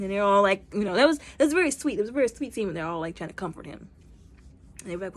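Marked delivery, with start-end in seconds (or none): none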